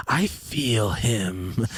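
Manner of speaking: drawn-out, soft creepy line; creepy horror-movie style. Acted. Boy spooked that he's being watched by the tracks, creepy. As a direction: stretch it out slowly, softly and eerily. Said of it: "some Jeepers Creepers shit".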